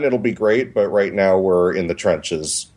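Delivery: usual speaking voice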